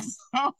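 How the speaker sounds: real high-pitched burst of laughter; helpless laugh. Real, not acted. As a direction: laugh you're trying to hold in. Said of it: "I'm trying not to laugh".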